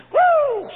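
high, loud preacher's woo; exultant woo; joyful whoop. Real, not acted. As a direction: whoop with delight. Preacher's exclamation.

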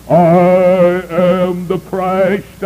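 slow, drawn-out monotone intoning. Retold or imitated. False Christ announcing himself to the crowd, slow monotone. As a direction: intone it flat and slow like a fake messiah. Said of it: "a fellow came out with a monotone voice".

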